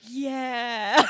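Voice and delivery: loud, drawn-out, rising whine; mock wailing agreement. Agreeing with a sobbing friend, mock wail. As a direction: go along with the wailing, exaggerated. Retells own tearful agreement.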